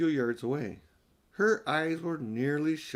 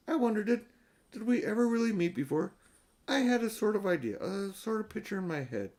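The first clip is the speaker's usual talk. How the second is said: put-on higher young girl, musing aloud; a girl half-remembering a dream, wondering; high girlish voice, dreamy and unsure; girl's voice; 8 semitones higher than usual.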